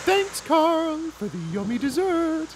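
very high cartoon cows; squeaky happy cows saying thanks; cow voices. Cows thanking Carl for dessert, very high. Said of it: "say the cows".

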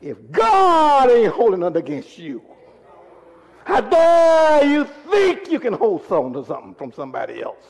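loud cry on GOD and DARE, falling away; impassioned rebuke. Real, not acted. Preacher challenging those who hold grudges, impassioned rebuke. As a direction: stretch key words into a preacher's shout.

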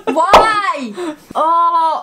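sudden loud yelp of surprise; startled cry. Real, not acted. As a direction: yelp as water hits. Water splashed into her eye.

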